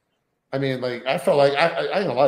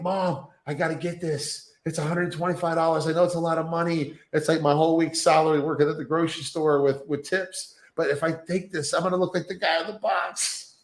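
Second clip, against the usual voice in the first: put-on pleading teen; 5 semitones higher than usual. Teen begging his mom.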